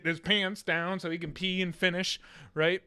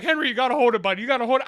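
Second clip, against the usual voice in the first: loud, higher, urgent pleading; retold panic; 6 semitones higher, 8 dB louder, faster than usual. Uncle begging his nephew to hold it, panicked. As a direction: plead urgently with the kid to hang on. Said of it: "I'm panicking".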